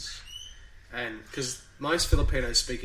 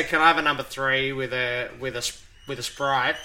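natural Australian accent, slightly raised voice; 5 dB louder than usual. Shown as a demonstration. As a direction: order in your own Australian accent. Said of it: "speak in my Australian accent".

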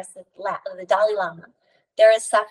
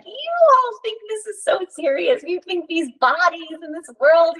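much higher, louder amused voice; high mock-wise sage voice; Yogananda laughing it off; 8 semitones higher, 4 dB louder than usual. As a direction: tease them like an old sage waking up. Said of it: "and he's like".

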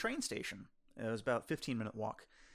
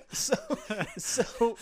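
laughing; 11 semitones higher, 5 dB louder than usual. Real, not acted. Laughing at the suitcase story.

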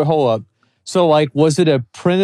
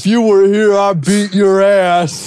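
put-on high, loud, dragged-out drunk threat; mock drunk; 5 semitones higher, 4 dB louder, slower than usual. Friend playing the streamer trolling his opponent, mock drunk. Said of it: "acting like I'm drunk".

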